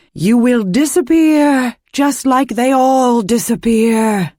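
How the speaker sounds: high, slow, booming witch threat; high and slow, loud and menacing; witch shout. A narrator's reading. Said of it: "she shouted".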